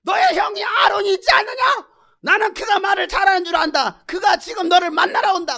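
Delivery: high, shouted scolding proclamation; furious God. Acted. The Lord tells Moses of brother Aaron, angry shouting. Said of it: "크게 노하시는 거예요".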